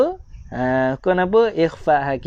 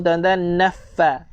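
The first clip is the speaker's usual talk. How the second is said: ordinary talk, nothing marked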